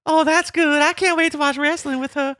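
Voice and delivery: squeaky cartoon voice for the wrestler; high silly voice. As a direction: pitch way up, playful. Mock voicing characters on screen.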